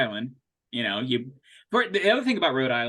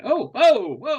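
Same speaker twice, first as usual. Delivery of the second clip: high, loud startled whoa; startled oh-whoa, pitched up; mock startled; 12 semitones higher, 7 dB louder, slower than usual. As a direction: act taken aback by the voice. Startled reaction to deep voice.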